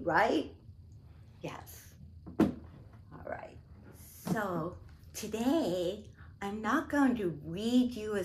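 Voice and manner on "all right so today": plain reading voice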